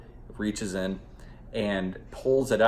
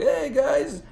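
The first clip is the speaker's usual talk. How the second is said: high, loud, sing-song greeting; fake-cheerful dad; 7 dB louder than usual. Cheating boyfriend greeting his family, high loud mock cheer. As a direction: put on a bright family-man hello. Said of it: "He's like".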